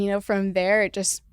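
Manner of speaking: ordinary talk, nothing marked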